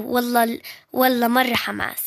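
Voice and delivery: normal talking voice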